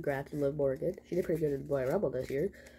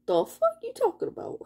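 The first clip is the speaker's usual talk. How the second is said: high, disbelieving outburst; shocked what-are-you-talking-about; outraged disbelief, pitched way up; incredulous; 5 dB louder than usual. Retold or imitated. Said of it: "I was like".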